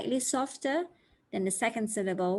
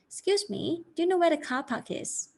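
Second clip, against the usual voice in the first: higher, friendly asking a stranger; polite stranger asking; 7 semitones higher than usual. Shown as a demonstration. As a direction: ask a passer-by politely. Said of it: "The first one is car park".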